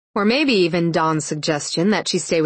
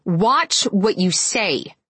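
snapped; 3 semitones higher, much slower than usual. A narrator's reading. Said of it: "she snapped".

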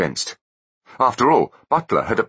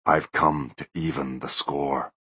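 ghostly voice; 5 semitones lower, 5 dB quieter than usual. Acted. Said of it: "said the voice".

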